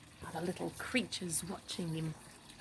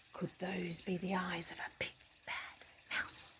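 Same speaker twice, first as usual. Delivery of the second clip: whisper; 8 dB quieter than usual. A narrator's reading. Reading to a child, spooky whisper. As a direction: hush it to make the child lean in.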